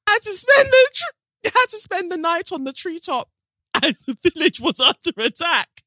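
high-pitched reading through giggles; laughing. Real, not acted. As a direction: try to read on while cracking up. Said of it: "I'm laughing".

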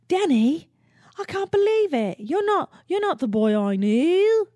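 high, loud, hammed-up Australian accent. Sandy confronting Danny in disbelief, high mock Australian accent. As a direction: wail it as a heartbroken Australian girl, stretching the end. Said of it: "That's my Australian accent".

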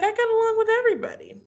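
mock boastful boyfriend. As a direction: toss it off like the easiest claim. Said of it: "And he's like".

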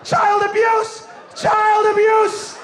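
high, slow, loud yelling; hammed-up outcry. Slapped pupil alerting the whole class, hammed-up shouting. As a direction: holler it out, stretching each word.

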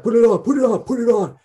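high, loud, urgent orders; frantic shouting. Retold or imitated. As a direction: bark it like a panicked drill. Staff storming stage with masks.

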